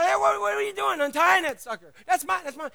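loud, high protest; angry owner. Acted. The owner yelling at men untying his donkey, angry. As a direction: bark at the thieves.

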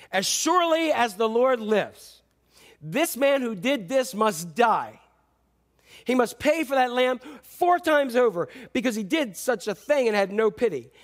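a touch of King David's fury; burning anger. Acted. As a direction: let the anger burn through each word. David's angry oath, read out.

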